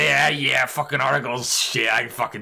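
put-on loose Brooklyn tough-guy accent, lightly done; mock Brooklyn accent. Imitating Jack brushing off the article, mock Brooklyn accent. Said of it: "Brooklyn accent".

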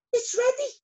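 high, triumphant announcement; thrilled storybook bee voice; excited shout. A narrator's reading. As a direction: announce success with a squeal. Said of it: "shouted Arthur".

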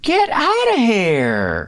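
high, slow Southern drawl; coy Southern belle brushing it off; Southern accent. Pretend. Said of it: "Say, um".

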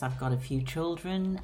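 ordinary talk

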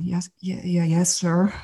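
soft, halting, timid assent; fearful, trembling. Retold or imitated. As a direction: stammer out a meek, quiet agreement. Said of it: "I'm fear and trembling".

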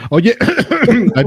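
ordinary talk

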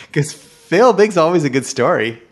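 excited. Real, not acted. Host still excited.

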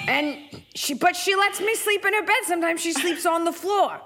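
high-pitched exasperated cat rant; worked-up streetwise cat voice; Gracie. Acted. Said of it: "This is Gracie".

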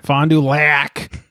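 "Fond du Lac" said very slowly, stretching "Lac"; slow, playful stretch on "Lac"; drawn-out place name. Real, not acted. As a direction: savour "Fond du Lac", drawing out the last word. Playfully repeating the town name.

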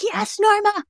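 high, excited mock cheering for Norma; cheering fans. Imagined people cheering Norma on, high mock cheer. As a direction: cheer her on like a proud crowd. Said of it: "people are gonna be like".

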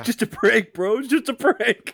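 high mock excuse; mock protest. Mock protest quoting a prankster.

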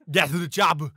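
jokey impression of an English accent; mock English accent. Co-host tests whether accents are allowed, mock English accent. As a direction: put on a comic English accent. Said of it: "impression of a guy doing an English accent".

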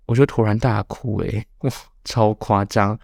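ordinary talk

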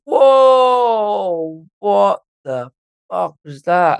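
a whole group spooked by a voice; startled shout. Retold or imitated. Everyone went.

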